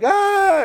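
loud, high mock scream; hammed-up kid's cry, running off; high wailing "aah"; child's wail. Kid runs off upset.

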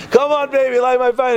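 high, urgent mock yell; mock scream. Baal prophet trying to get fire, high mock scream. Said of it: "Why don't you scream a little louder".

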